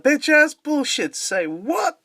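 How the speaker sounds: high-pitched, hammed-up disbelief; mock incredulous outburst. Acts out his actor's outraged catchphrase.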